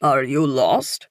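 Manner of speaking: put-on slow, French-accented question; older Frenchman asking slowly; thick French accent. A narrator's reading. Older man asks a stranger, thick French accent, slowly. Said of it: "he says with a thick French accent".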